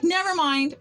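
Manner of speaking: mild mock yell of never mind; retold loud snap. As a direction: bark it out dismissively. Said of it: "like, real loud".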